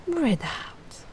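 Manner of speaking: unvoiced breathy out-breath cue; whispered guide. Shown as a demonstration. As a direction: let the air go with them. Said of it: "Just follow my lead".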